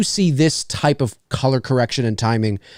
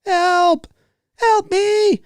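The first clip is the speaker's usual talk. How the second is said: mock cry for help; much slower than usual.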